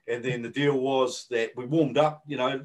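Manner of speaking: normal talking voice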